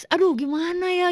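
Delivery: fretful "what do I do"; retold fretting. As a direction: lightly re-enact a worried plea. Retells own distress to father.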